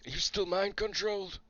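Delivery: high, loud suspicious call; wary shouted challenge. Acted. Wary soldier questioning someone maybe mind controlled, shouted challenge. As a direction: shout the question, keeping your distance.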